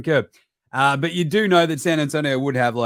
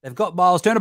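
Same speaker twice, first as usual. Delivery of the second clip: normal talking voice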